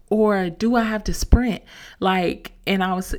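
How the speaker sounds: ordinary talk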